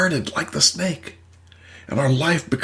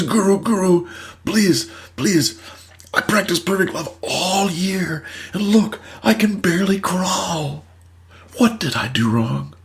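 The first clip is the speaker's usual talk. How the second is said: higher, begging plea; pleading snake; 5 semitones higher than usual. Acted. Battered snake begging the guru for help, higher pleading. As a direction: beg the master for an answer.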